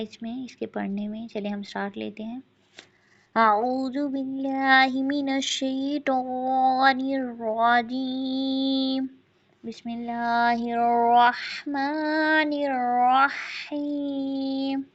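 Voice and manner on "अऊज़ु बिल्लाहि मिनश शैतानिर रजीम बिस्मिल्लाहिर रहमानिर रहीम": slow, melodic tajweed recitation; Quran recitation. Acted. Teacher recites surah for students in slow melodic tajweed. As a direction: chant each verse slowly and clearly.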